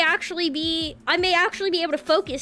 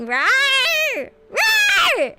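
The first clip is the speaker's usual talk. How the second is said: high-pitched mock roar; playful rawr; 7 semitones higher, 3 dB louder than usual. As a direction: let out two high cartoon roars. Playful animal roars while greeting chat.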